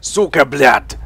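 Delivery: loud mock Russian swearing, spat out; yelling Russian curse at a teammate, imitated; Russian gamer curse. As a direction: bark it like an angry Russian teammate. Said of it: "all I hear when I play is".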